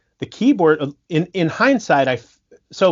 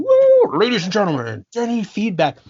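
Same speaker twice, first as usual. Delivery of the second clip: mock stage announcer; 8 semitones higher than usual. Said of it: "I would just be like".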